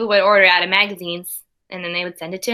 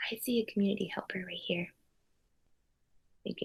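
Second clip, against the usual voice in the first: soft secret whisper to the class; hushed aside to little listeners; 17 dB quieter than usual. Real, not acted. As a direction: lean in and whisper the hint. Aside to children during story.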